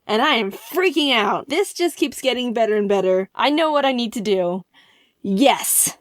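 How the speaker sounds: ordinary talk, nothing marked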